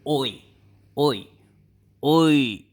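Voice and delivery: loud oi called out; loud showcase of the word oi; demo call. Shown as a demonstration. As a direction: call out oi as a demonstration. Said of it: "the word of the day is the word".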